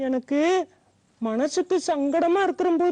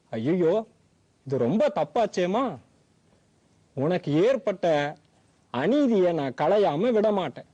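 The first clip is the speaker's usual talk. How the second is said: a much lower grandpa voice, firm and upset; indignant old grandfather; 11 semitones lower than usual. Acted. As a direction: vow to right the wrong, low and resolute.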